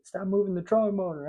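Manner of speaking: high-pitched, urgent mock holler; retold hollering. Angler with a fish on calls to his buddy, retold hollering. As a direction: imitate yourself hollering, without full volume. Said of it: "I'm hooting and hollering".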